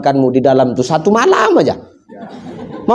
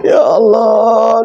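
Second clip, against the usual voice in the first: higher, slow hammed-up wail; drawn-out mock sob of a greedy husband; fake wailing; 4 semitones higher, much slower than usual. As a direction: stretch out a fake mourning cry. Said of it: "nangis bapak tuh".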